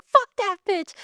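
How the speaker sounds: very high, sneering put-down; a high mocking scoff at the ex; dismissive outburst. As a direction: spit it out high and scornful. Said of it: "I'd be like".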